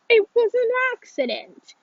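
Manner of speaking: high, loud protest of innocence; urgent, indignant self-defense; defensive outburst. A narrator's reading. Alex defends himself in quarrel.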